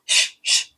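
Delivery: শ- as an unvoiced hiss, like a shush; voiceless শ-, শ- as in hushing someone. Shown as a demonstration. Teacher showing the everyday shushing sound. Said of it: "ইশারা করতে গিয়ে আমরা অনেক সময় বলি".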